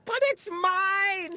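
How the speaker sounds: high indignant mock scream; a storybook scream of a possessive bird. A narrator's reading. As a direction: shriek it like a jealous bird. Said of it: "screamed the bird".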